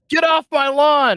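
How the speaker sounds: high, loud, drawn-out holler; mock yell. Player jokingly supplies the villain's line, high mock yell. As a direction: yell it like someone chasing off trespassers.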